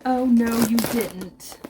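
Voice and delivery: usual speaking voice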